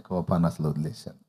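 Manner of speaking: low, gentle and over-serene; hammed-up calm of a reformed man; lightly put-on serenity; mock saintly patience. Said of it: "బాగా దీర్ఘశాంతంతో".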